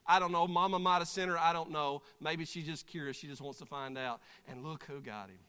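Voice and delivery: normal talking voice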